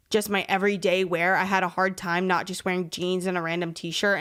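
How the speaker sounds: ordinary delivery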